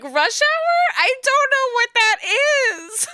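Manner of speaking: very high, almost shouting in mock panic; shocked disbelief. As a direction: squeal the title, then wail. Retold disbelief at the answer.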